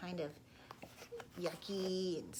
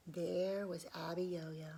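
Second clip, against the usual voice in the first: plain reading voice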